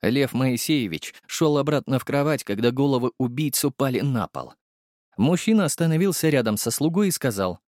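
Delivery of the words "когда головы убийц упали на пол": ordinary delivery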